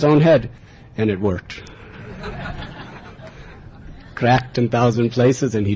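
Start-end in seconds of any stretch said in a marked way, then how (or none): 1.8-4.1 soft breathy chuckle; a quick amused laugh; light laugh. Real, not acted. Storyteller laughs as the demon falls for the trick, softly.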